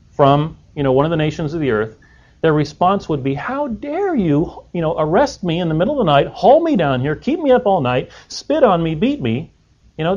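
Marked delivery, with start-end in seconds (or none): 3.3-9.5 mock outraged king, pitch raised; indignant king. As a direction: protest like an affronted monarch, drawing out the opening. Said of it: "can you imagine if this was a king".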